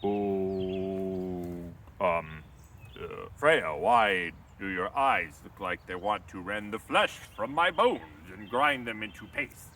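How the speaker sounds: nervous giant. Acted. Thrym, spooked by the bride's eyes, asks nervously. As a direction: stammer out an uneasy question. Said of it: "Thrym is like".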